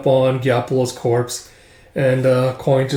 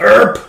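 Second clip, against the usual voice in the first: high, loud cut-off yelp; short cry of being hit; startled yelp; 9 semitones higher, 8 dB louder than usual. Acted. Acted cry as blow lands.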